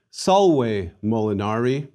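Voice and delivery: salve molinari slowly as SAHL-way moh-lee-NAH-ree; model pronunciation. Shown as a demonstration. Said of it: "Please repeat after me".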